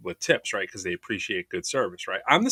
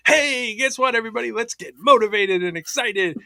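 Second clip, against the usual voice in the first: much higher, pumped event-host call; rally the crowd like a paid host; hype host voice; 12 semitones higher, 6 dB louder than usual. Retold or imitated. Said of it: "come out and be like".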